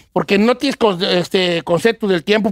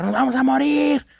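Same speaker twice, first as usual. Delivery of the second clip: retold panic scream; 5 semitones higher than usual. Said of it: "empezó a gritar".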